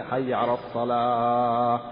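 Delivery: حي على الصلاة drawn out slowly with a long held الصلاة; stretched call. Shown as a demonstration. Teacher mimicking a muezzin who stretches the second call, slow. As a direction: lengthen it like an overdone muezzin. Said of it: "ثم الثانية".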